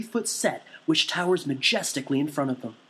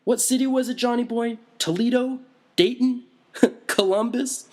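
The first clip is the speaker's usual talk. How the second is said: much higher, louder, sing-song needling; mocking taunt; 9 semitones higher, 4 dB louder than usual. A narrator's reading. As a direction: rub it in with a laugh.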